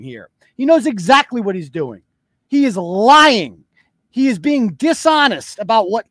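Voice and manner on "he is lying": slow and deliberate, every word weighted; slow emphatic accusation. Real, not acted. Host to viewers, slow and deliberate. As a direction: spell it out slowly like a verdict. Calling out the man's lie.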